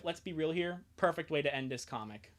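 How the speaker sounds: normal talking voice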